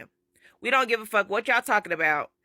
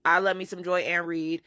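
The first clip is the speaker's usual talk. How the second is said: normal talking voice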